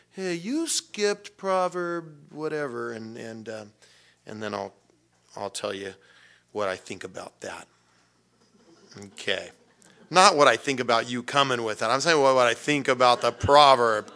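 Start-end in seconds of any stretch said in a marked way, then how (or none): 0.1-2.9 slow, raised questioning voice; student asking. Pretend. 10.1-14.1 louder, quick correction stressing not; emphatic. Real, not acted. Clarifying a joke, emphatic.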